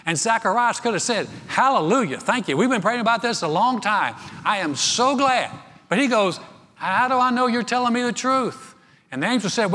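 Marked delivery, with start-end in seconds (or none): 1.5-5.5 higher, louder joyful praise; joyful hallelujah. Retold or imitated. How Zacharias should have rejoiced. 6.8-8.8 higher, louder incredulous challenge; incredulous doubt. Retold or imitated. Retold doubt, preaching louder.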